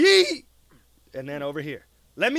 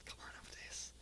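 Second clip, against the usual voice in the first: barely audible whisper; hushed, private plea; whispered urging; 29 dB quieter, faster than usual. Real, not acted. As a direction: coax under your breath. Pleads under breath with game character.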